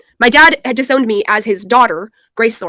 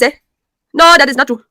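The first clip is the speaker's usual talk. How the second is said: put-on loud Nigerian accent, higher pitch; 4 semitones higher, 6 dB louder than usual. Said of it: "do the African accent".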